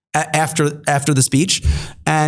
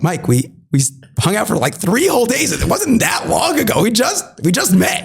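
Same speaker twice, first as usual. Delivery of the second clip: exasperated disbelief; 5 semitones higher than usual. Retold or imitated. Exasperated retort to Mike.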